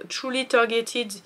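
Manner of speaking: slow, careful English product name with a French accent; spelling out an English brand name in a French accent. Real, not acted. Reading an English product name to viewers, in a French accent. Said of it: "Désolée pour mon accent dégueulasse".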